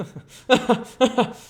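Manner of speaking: high, loud sneering cackle; unpleasant laugh. Acted. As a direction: let out a nasty gloating laugh. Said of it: "with an unpleasant laugh".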